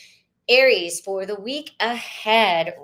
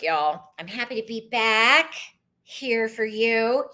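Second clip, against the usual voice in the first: ordinary talk, nothing marked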